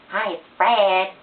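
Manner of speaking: high, slow, loud squeaky put-on voice; Fred voice. Showing viewers her Fred voice, high and squeaky. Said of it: "do a Fred voice".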